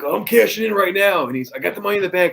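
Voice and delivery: high, loud wrestler growl; Macho Man impression. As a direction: ham up the wrestling promo, pumped up. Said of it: "Macho Man is very strong".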